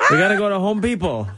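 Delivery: laughing through dad's phrase; laughing quote. Retold or imitated. Host quoting a listener's dad, laughing. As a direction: giggle while quoting. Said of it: "my dad says".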